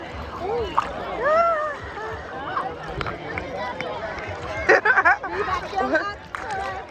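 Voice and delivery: long, high bout of laughter; amused laughing. Real, not acted. Vlogger watching the little lifeguard, amused laughing. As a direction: laugh softly and keep it going.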